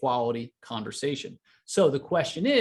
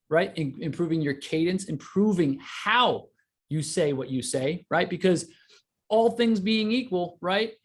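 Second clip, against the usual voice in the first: usual speaking voice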